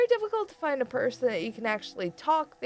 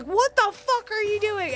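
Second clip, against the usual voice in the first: higher, exasperated mock yell from the friend; friend's exasperated yell; 13 semitones higher, 4 dB louder than usual. Retelling a friend's call on voice chat, a mock exasperated yell. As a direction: throw the friend's 'what are you doing' at her, half-shouted. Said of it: "my friend that was just like".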